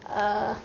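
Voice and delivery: UH held long, low and level; low hesitating tone. Shown as a demonstration. Teacher shows half third tone, low hesitating sound. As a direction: stretch UH like a hesitation, staying down.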